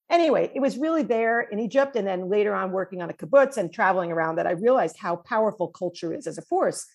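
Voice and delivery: ordinary delivery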